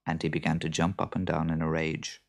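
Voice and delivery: usual speaking voice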